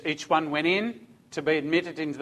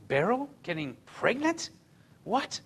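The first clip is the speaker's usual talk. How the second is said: high, soft, incredulous questions; shocked grandma; 6 semitones higher, 7 dB quieter than usual. Acted. Grandma learns her daughter is pregnant, shocked disbelief. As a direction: imitate a stunned grandma, pitch leaping up.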